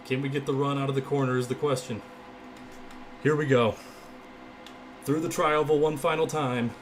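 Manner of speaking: loud race commentary; urgent play-by-play, voice pushed up; live play-by-play. Real, not acted.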